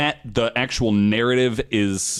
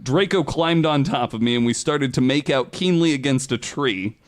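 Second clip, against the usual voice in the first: mock-earnest fanfic reading, a bit higher; dramatic reading; 4 semitones higher than usual. Host reading bad fanfic to cohosts, mock-dramatic read. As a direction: read the trashy prose as if it were literature.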